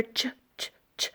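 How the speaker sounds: "ch, ch, ch" as short, soft, voiceless sound bursts; phonics sound. Shown as a demonstration. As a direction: clip out the "ch" sound softly for the kids. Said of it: "Many of you know the H brother".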